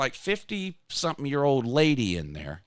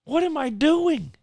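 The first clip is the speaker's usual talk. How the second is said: self-reproach; 10 semitones higher, 5 dB louder, slower than usual. Retold or imitated. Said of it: "and I'm like".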